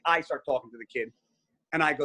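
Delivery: usual speaking voice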